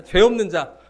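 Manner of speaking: 죄 hit loud to correct the slip; emphatic correction. Real, not acted. Preacher fixing his misquote, stressing 죄 loudly. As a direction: land firmly and loudly on 죄.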